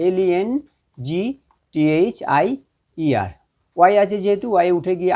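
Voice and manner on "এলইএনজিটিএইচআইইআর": very slow, spaced-out letters; spelling out. Shown as a demonstration. Teacher spells the comparative for students, slowly letter by letter. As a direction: give each letter its own beat.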